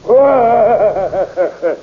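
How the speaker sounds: loud, high, gloating laugh; booming laugh. Acted. King laughing at the princess, gloating laugh. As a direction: laugh her down.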